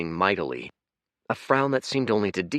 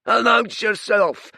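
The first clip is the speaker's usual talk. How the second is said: much higher, louder, slow furious roar; booming creature; 9 dB louder than usual. Acted. Red giant bellowing at the rowing stranger, furious roar. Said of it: "His voice was gravelly, deep, and totally hoarse".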